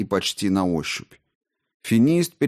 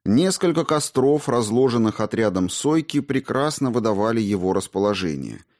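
ordinary delivery

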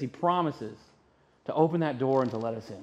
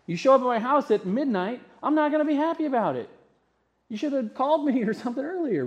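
mock annoyance; 8 semitones higher, faster than usual. Voicing annoyed human reaction.

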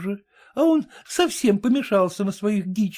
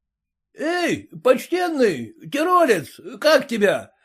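крикнул; 7 semitones higher than usual. A narrator's reading. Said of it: "крикнул разносчик".

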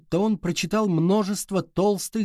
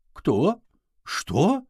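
startled questions climbing higher; rising toward a squeal; 5 semitones higher than usual. A narrator's reading. Woman cornered by questions about her attacker, voice rising toward a squeal. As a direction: let the voice climb on each short question. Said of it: "Голос женщины поднялся до визга".

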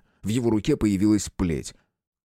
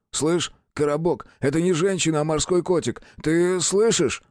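excited subordinate; 8 semitones higher than usual. Acted. Подчинённый кричит по рации.

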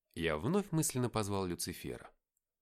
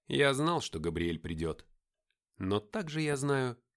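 usual speaking voice

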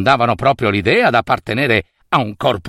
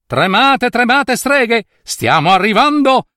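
excited battle cry; 10 semitones higher than usual. Acted. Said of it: "disse Marta".

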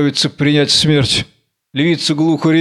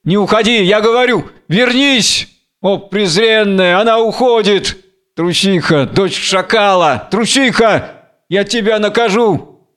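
higher, declaimed indignation; acted fury; 6 semitones higher than usual. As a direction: hurl reproaches after her, rage held in check. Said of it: "полон ярости". (сдержанно сыгранная ярость, повыше)

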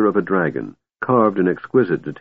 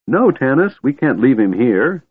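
high, plaintive plea; wailing protest; 6 semitones higher, 3 dB louder than usual. A narrator's reading. Kender begs Tanis not to leave old man, wailing. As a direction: plead with pitch raised at the start. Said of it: "Tass wailed".

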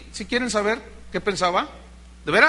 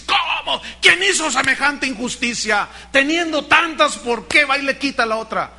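indignant outburst, higher pitched; angry king; 4 semitones higher, 3 dB louder than usual. Acted. King David reacting to the story of the stolen lamb, angry. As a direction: act out a king's outraged demand. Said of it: "el rey se enoja".

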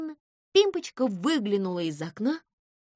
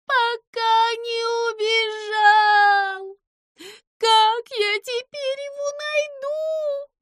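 slow high sobbing wail; 13 semitones higher, 7 dB louder, much slower than usual. A narrator's reading. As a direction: howl it out through tears. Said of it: "Пимпочка снова заревела".

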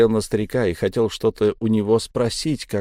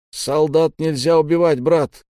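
higher, raised voice calling out; barked shout; 5 semitones higher, 4 dB louder than usual. A narrator's reading. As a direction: bark it at a comrade ahead. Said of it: "гаркнул позади меня Буран".

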